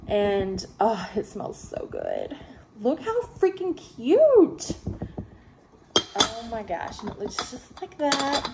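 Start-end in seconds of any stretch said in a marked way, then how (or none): 2.8-4.7 gushing. Real, not acted. Gushing over a cute warmer.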